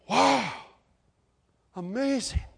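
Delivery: high, awed mock wonder; amazed. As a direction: marvel in a lifted voice.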